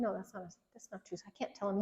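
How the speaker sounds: normal talking voice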